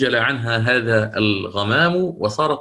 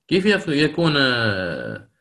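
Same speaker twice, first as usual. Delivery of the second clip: ordinary talk, nothing marked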